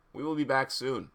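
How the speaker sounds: plain reading voice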